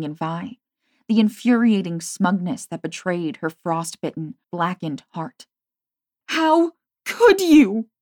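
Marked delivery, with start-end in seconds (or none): 6.3-7.9 high, slow, anguished near scream. A narrator's reading. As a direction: let it tear out of her. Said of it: "Her voice rose to a near scream".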